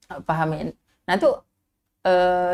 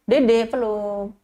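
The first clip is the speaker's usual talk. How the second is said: slow coaxing; much slower than usual. Shown as a demonstration. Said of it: "itu saya biasa".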